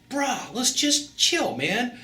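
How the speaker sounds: high, slow laid-back island drawl; reggae vibe. Retold or imitated. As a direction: stretch it out like a chilled-out surfer. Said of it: "got the reggae vibe going".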